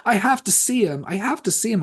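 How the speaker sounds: high, pleading and urgent; desperate. Retold or imitated. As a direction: beg it high and breathless. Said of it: "compelled to be like".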